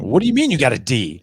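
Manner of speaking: loud, incredulous demand about the D; accusing parent. Shown as a demonstration. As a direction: bark it like a strict old-school parent. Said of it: "Not saying".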